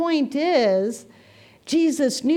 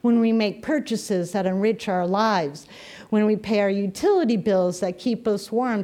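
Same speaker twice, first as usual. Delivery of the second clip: ordinary talk, nothing marked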